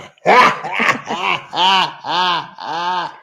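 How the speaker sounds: big bright burst of laughter; laughing out loud at a mistake. Real, not acted. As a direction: crack up loudly at the slip. Laughs at own flub.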